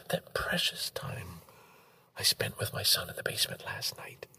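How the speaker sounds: softly whispered memory. Real, not acted. Father whispering about time with his son. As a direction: hush it, tender and close.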